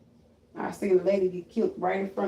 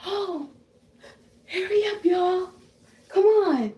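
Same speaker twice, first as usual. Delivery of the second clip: high, urgent voice, building louder; 10 semitones higher, 3 dB louder, slower than usual. Retold or imitated. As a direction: urge everyone to hurry in a put-on voice.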